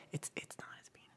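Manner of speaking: cheeky hushed aside; whisper. Real, not acted. Streamer whispering a rude joke to chat. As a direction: drop to a whisper for the rude bit.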